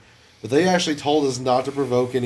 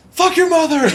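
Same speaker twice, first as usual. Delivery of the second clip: high, loud comic yell; mock shouted insult; 8 dB louder than usual. Host performs the mistaken caption, high mock shout. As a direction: shriek the insult way up high for laughs.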